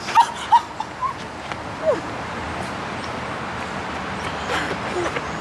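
high breathless shriek of joy; shrieking laugh. Real, not acted. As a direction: squeal with disbelief. Learns trip is Paris.

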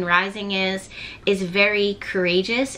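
ordinary delivery

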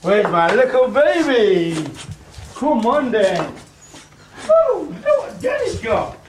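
very high, squeaky coo to a pet. Real, not acted. Talking to his dog.

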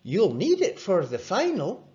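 a pal dropping in on the patient; lifted, deliberate young friend; Rory's friend Angus. A narrator's reading.

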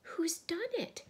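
high, loud, drawn-out demand; crying out. A narrator's reading. Susan at the empty table, high distressed cry. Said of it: "cried Susan".

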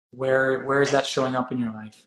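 ordinary talk

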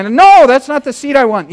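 high, loud frustrated cry; retold exasperation at a booking website; exasperated protest. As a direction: blurt it out like you've just been wronged. Protesting the wrong seat assignment.

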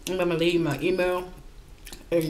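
speaking between bites; talking while chewing. Real, not acted. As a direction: mouth full of chicken. Seen 1: Wing held in mouth and bitten repeatedly 0.3–6.2s, then talks holding it.

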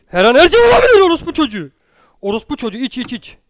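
building to a loud panicked cry; frightened yell. Real, not acted. Player bracing for a scare, panicked shout. Tense moment in horror game.

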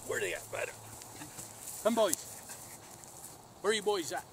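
loud, high call to distant dogs. Real, not acted. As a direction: shout across the field for the dogs. Calling dogs from afar.

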